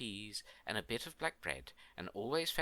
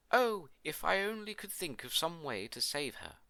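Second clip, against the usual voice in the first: higher, louder cry of despair; 5 semitones higher, 5 dB louder than usual. A narrator's reading. Queen wishing she could save her baby, despairing. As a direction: exclaim it in anguished wishing. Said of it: "The queen despaired".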